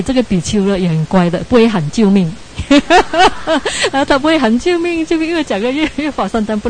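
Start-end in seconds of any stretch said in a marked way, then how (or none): 2.7-6.7 high-pitched, giggly retelling after a real laugh; laughing while talking. Real, not acted. Teacher amused that the monk cannot cry for help, laughing. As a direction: burst out laughing, then talk on in a high voice.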